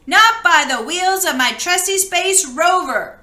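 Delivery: brave cry. Acted. Little alien defying the big robot, bravely. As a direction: plant your feet and cry it out bravely. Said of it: "cried Bork bravely".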